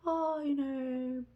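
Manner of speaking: high, drawn-out mock chatty voice; imitating other parents. Other parents talking to a homeschooling mother, high slow imitation. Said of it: "I get people talking like".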